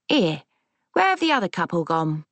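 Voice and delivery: lightly voiced Cockney gran; puzzled grandmother with a touch of Cockney; Cockney accent. A narrator's reading. Confused gran asking granddaughter about a couple, in Cockney. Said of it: "in the Cockney accent she'd never lost".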